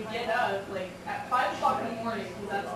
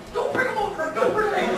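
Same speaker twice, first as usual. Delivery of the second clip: high, urgent mock pleading; panicked plea; 10 semitones higher, 7 dB louder, faster than usual. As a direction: beg them to keep the geese away. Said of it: "It's like".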